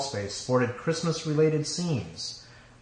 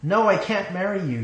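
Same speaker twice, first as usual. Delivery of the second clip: firm, raised, high refusal; clearly enunciated; 8 semitones higher, 5 dB louder than usual. A narrator's reading. Bride refusing the groom, clearly enunciated and firm. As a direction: say it loud and distinct. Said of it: "she enunciated clearly".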